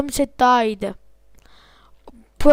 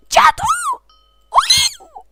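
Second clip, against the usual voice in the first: frantic high-pitched oo; high excited yelps; 4 dB louder, much slower than usual. Real, not acted. Gamer panicking mid-fight, high squealing yelps.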